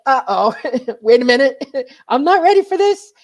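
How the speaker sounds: high, loud nervous laugh through the words; startled giggle breaking into the words. Retold or imitated. Startled by beings at window.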